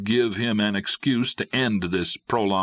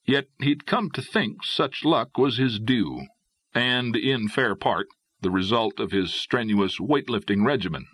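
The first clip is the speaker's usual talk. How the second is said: ordinary delivery, nothing marked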